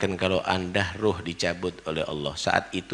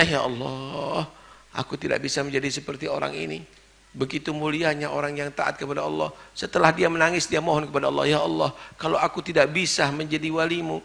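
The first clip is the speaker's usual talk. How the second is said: mock tearful prayer; 8 semitones higher than usual. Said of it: "Yang ditangisi dirinya".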